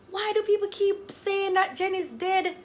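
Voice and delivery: high-pitched incredulous protest; exasperated begging. Retold or imitated. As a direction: plead in disbelief, voice way up. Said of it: "breathless begging everybody".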